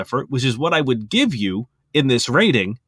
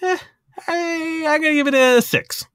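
much higher, strained hesitant voice; strained hesitation. Real, not acted. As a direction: squeeze out the rating reluctantly. Struggling to pick a rating.

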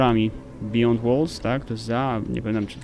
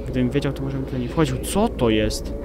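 normal talking voice

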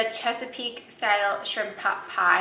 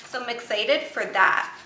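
ordinary delivery

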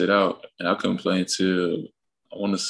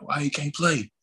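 higher mock yell; coach yelling back; 7 semitones higher, 3 dB quieter than usual. Coach yelling back at the dad, higher mock yell. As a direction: toss the question back across the gym. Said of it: "yelling back at him".

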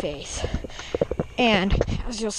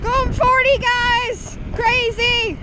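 excited; 11 semitones higher, 4 dB louder, much slower than usual. Real, not acted. Riding fast, raised voice.

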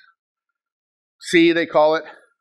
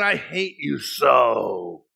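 slow bitter quote; 5 semitones lower, much slower than usual. Retold or imitated. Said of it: "I remember a guy saying".

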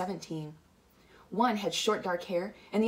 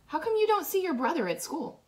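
put-on much higher-pitched girl's question; girl character; 12 semitones higher, 4 dB louder than usual. A narrator's reading. Marcia asking about the brother at school, high-voiced.

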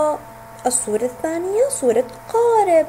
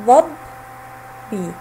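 "ظبي" very slowly, split as ظبـ then ي; teacher's slow syllable-by-syllable "ظبي"; broken into sounds; 3 semitones lower, much slower than usual. Shown as a demonstration. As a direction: sound out "ظبي" with a long pause before the final ي. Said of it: "وجاء بعده حرف الباء ساكنا".

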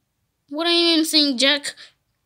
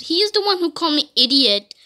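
usual speaking voice

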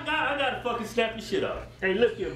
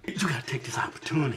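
fast whisper; 9 semitones lower, faster than usual. Real, not acted. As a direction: whisper it quickly. Whispered line.

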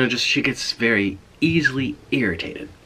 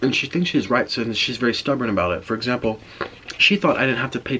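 chewing between words; talking around food; mouth full of pizza. Real, not acted. Seen 2: Bites pizza at -1.0; mouth looks full and working while talking.

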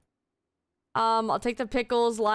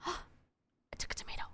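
gasp, then whisper in mock horror; hushed shocked confession; 16 dB quieter than usual. Player gasps in mock horror at eating tomato.

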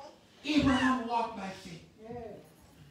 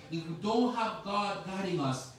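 ordinary delivery

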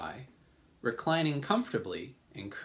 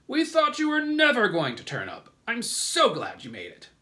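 put-on old grasshopper character; 11 semitones higher, 8 dB louder than usual. A narrator's reading. Old green grasshopper glad James turned up, loud exclamation. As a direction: exclaim high, then bring the pitch down. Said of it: "the old green grasshopper said".

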